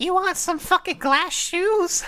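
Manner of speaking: very high, slow cackling witch voice. Acted. Fairy godmother offering Cinderella shoes, high cackling witch. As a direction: screech it out like a mad fairy witch. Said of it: "cackling witch".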